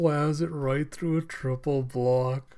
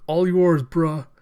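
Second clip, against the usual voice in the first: higher, louder court call; called-out handoff; 3 semitones higher, 7 dB louder than usual. Retold or imitated. Player passing the ball to a teammate, called out loud. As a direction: shout it to a teammate.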